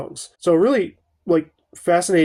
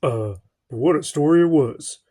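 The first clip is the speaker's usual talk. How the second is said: a hint of a warm movie narrator; lightly imitating a film's opening narration; mild mock narrator voice; bassy narrator. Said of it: "that really bassy, warm narrator's voice".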